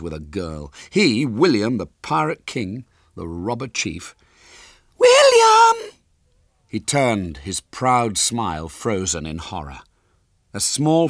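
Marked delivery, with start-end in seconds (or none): 5.0-5.9 put-on piercing, very high child's shout; calling out. A narrator's reading. Small girl hails William from behind, shrill shout. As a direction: shriek the name like a small girl.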